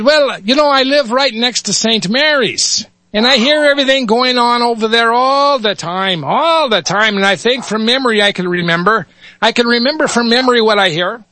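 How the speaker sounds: put-on high, chatty Yiddish-accented voice; Yiddish rabbi. Rabbi explains he hears Saint Mary's, Yiddish accent. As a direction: explain eagerly like an old rabbi. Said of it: "switch between dialects here".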